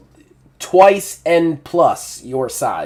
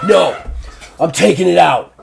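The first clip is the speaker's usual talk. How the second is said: loud furious bull, pitched up; angry bull; 6 semitones higher, 8 dB louder than usual. Acted. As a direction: roar it out as the bull snaps. Bull enraged.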